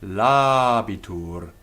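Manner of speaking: "Labitur" slow, first a held long and loud; long first vowel. Shown as a demonstration. Teacher proving the syllable is long, "Labitur" with long a. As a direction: stretch the La of "Labitur". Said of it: "but it's long".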